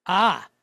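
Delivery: "Ah" held as an open ah vowel; vowel demo. Shown as a demonstration. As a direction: model the kamatz vowel as an open "Ah". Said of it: "what vowel sound should we have".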